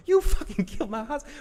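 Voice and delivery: high-pitched falsetto retort; hammed-up squeaky comeback in a female role; mock angry woman. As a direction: jump way up into a mock-furious falsetto. Acting Maggie accusing Negan.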